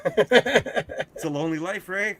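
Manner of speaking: loud, slow, drawn-out mock sorrow; milk it like a tragic hero; mock lament. To Ray, a loud, drawn-out mock-tragic sigh.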